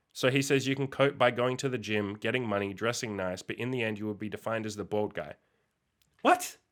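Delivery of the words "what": very high-pitched yelp of disbelief; incredulous. Real, not acted. Reacting to the claim on bald men, incredulous. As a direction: squeak it out, utterly baffled.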